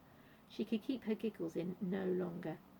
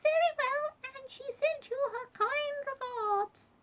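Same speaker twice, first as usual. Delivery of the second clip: very high squeak, slowing at the end; squeaky Duchess; 4 dB louder, slower than usual. A narrator's reading. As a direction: squeak grandly, drawing out the last words. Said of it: "squeaked the Duchess".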